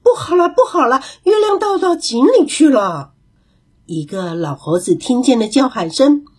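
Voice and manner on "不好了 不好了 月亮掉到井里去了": higher, calling out in alarm; a big monkey raising the alarm; 大猴子大声惊呼; loud alarmed shout. A narrator's reading. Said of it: "也大声地喊起来".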